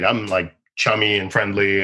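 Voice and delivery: usual speaking voice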